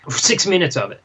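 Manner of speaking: ordinary talk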